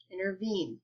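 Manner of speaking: two light syllables, then stressed "vene" in "intervene"; stress on last syllable. Shown as a demonstration. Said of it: "the last syllable of that word is stressed".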